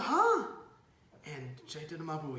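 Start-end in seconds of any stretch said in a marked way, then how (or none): 0.0-0.5 high, loud startled gasp of a word; shocked. Retold or imitated. Chaitanya learns it is liquor, shocked. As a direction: react with mock shock.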